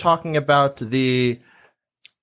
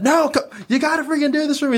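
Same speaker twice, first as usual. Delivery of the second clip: high, fast retold begging; worked-up high-pitched insisting; insistent pleading; 13 semitones higher, faster than usual. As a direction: push your friend urgently, pitched way up. Insisting to reluctant friend, retold.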